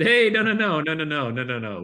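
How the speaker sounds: starts high and loud, then fades down to normal; eager rush of no's. Retold or imitated. To the event organizer, grabbing the offer: excited no's fading. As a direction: tumble out the no's as if snapping up a deal, settling down. Eagerly accepting the speaking fee.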